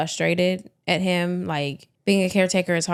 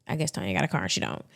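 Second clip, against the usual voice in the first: ordinary talk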